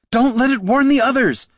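high, loud urgent cry; cried out. A narrator's reading. Catha as the scout darts away, crying out. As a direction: call out in alarm. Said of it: "cried Catha".

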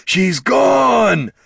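long, high troll shout; panicked bellow. A narrator's reading. Troll storming around the camp, panicked bellow. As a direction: wail it at full volume. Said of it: "bellowed Thane".

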